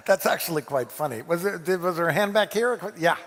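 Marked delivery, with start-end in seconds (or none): none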